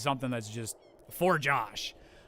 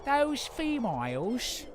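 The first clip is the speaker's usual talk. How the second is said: slow, much higher, hammed-up British accent; terrible British accent; 10 semitones higher, slower than usual. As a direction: play a silly Brit, stretching every word. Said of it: "I'm so sorry to all the British that I've offended".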